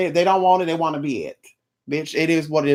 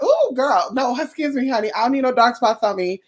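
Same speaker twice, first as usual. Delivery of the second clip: higher, fussy diva refusal; prissy diva; 5 semitones higher than usual. Pretend. Imagining having porcelain skin, a fussy higher diva refusal. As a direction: wave them off like a precious porcelain doll.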